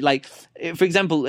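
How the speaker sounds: ordinary talk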